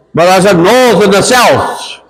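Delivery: ordinary talk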